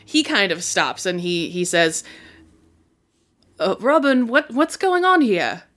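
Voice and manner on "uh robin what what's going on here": higher, hesitant British voice; generic British accent, pitched up; awkward British. Acted. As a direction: stammer it as an awkward British man. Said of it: "awkward British voice".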